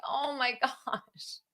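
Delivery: slow, high, soft laugh through the words; a soft "oh my gosh" broken by a laugh; laughing gasp. Retold or imitated. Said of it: "And I was like".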